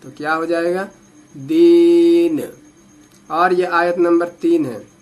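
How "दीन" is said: दीन held long and loud with a silent न; drawn-out stop. Shown as a demonstration. Teacher showing the stop on the last word. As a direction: stretch दीन and close on a still न. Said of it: "जज़म लगा देना है".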